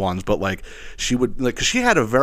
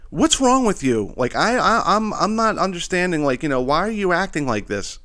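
put-on upset girlfriend; 8 semitones higher than usual. Her upset phone call.

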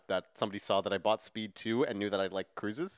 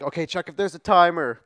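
louder, higher mock yelled instruction; retold yelling; 5 semitones higher, 8 dB louder than usual. Cameron relaying bomb instructions by cell phone, mock yell. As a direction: imitate someone calling instructions down a phone. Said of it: "yelling out the instructions from the cell phone".

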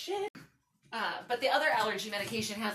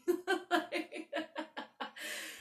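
high, long giggling laugh; 8 semitones higher than usual. Real, not acted. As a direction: burst out laughing at yourself. Laughing at own story.

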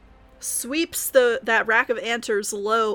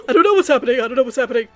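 louder, frantic panicky yelp; panicked wolf voice; 8 dB louder, much faster than usual. Acted. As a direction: blurt it out in a panic. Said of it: "And from the other".

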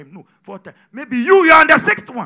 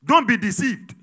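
ordinary talk, nothing marked

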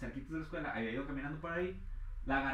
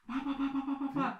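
rapid high "pa pa pa" like gunshots; imitated gunfire; 12 semitones higher, 4 dB louder than usual. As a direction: rattle it off like a machine gun.

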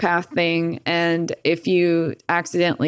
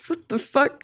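dad's startled outburst; 9 semitones higher than usual. Retold or imitated. Dad reacting to fight.

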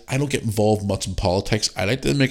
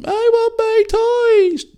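put-on much higher, slow, dragged-out toddler whine; whiny child voice; 6 dB louder, much slower than usual. Mocking Biden as a toddler wanting toys, high whine. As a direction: whine it like a kid who lost his toys.

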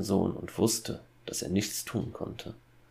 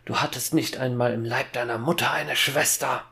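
raised, forceful voice bursting out; angry outburst; 5 semitones higher, 4 dB louder than usual. A narrator's reading. Father insisting to his son there was no sister, angry outburst. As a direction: let it explode, pitched up. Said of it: "platzte es aus dem Vater heraus".

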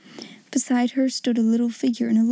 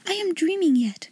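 put-on awed little girl; 5 semitones higher than usual. Sarah's voice, amazed.

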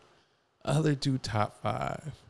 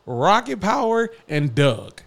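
heated rant; 7 semitones higher, 6 dB louder than usual. Real, not acted. Heated hot take.

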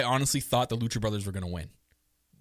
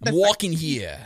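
louder, hammed-up Queens accent; mock Queens accent; 3 semitones higher, 5 dB louder than usual. Podcaster teasing co-host about her roots, exaggerated Queens accent. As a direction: do a caricature Queens New Yorker. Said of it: "that's that Queens accent".

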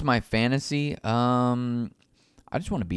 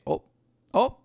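short Wisconsin "op"; Wisconsin accent; 11 semitones higher than usual. Retold or imitated. Host imitating how they say it in Wisconsin, Wisconsin accent. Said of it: "In Wisconsin, they say".

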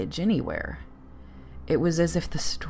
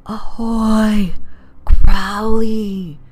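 loud, high, hollow call of a ghost ship; rasping hollow ghost voice; 6 semitones higher, 13 dB louder, much slower than usual. Acted. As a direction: rasp it out across the water like the dead hailing. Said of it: "a rasping, hollow voice".

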